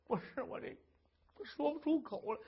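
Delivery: hushed, barely voiced start; ashamed wife. Wife too ashamed to tell her husband, hushed. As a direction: swallow the words in shame.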